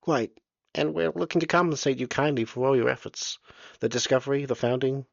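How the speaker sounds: oily politeness of a small bureaucrat; fussy little official. Acted. Surveyor offers compensation.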